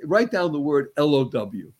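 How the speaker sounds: ordinary delivery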